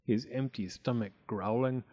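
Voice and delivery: plain reading voice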